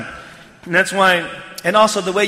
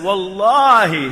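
WALLAHI stretched slow and firm; drawn-out emphasis; 4 dB louder, much slower than usual. Retold or imitated. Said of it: "look at the way he spoke to him".

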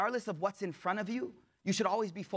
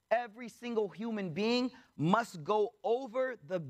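ordinary talk, nothing marked

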